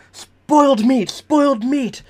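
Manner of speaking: high-pitched imitation of someone yelling; hammed-up high cries of distress; mock yelling. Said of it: "So, you start yelling".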